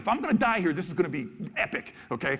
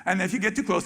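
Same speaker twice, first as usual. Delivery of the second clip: usual speaking voice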